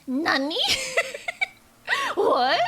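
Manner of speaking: startled high laughing what; surprised laugh. Real, not acted. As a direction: gasp then giggle. Surprise at game event.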